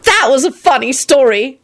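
high, emphatic exclamation; loudly added. A narrator's reading. Elizabeth recalling his detention story, high and loud. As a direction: pipe it up high and boldly. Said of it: "Elizabeth added loudly".

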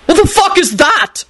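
very high, outraged screech without much volume; high-pitched scream. Acted. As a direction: shriek it in horrified disbelief. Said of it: "screamed in a high-pitched voice".

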